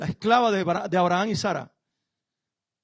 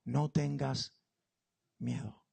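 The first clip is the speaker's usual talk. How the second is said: suave consuelo de ángel; 6 semitones lower, 11 dB quieter, much slower than usual. Acted. Ángel habla a Agar.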